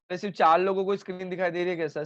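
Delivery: ordinary talk, nothing marked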